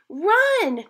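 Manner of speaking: high, loud sharp shout. Acted. Laura signaling Hannah to start running, high loud shout. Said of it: "shouted Laura".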